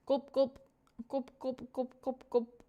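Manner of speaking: short, evenly spaced pretend gulps; imitated gulping. Streamer playfully voices drinking for chat, imitated gulping. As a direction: say each gulp as a little swallowing noise.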